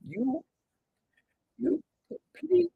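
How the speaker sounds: a quiet doting squeal; soft high coo. Pretend. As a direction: breathe it out tenderly high. Soft high gushing again.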